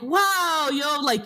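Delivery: high, drawn-out mock-amazed reaction; high-pitched incredulous retort; mock amazement. Acted reply to a microaggression.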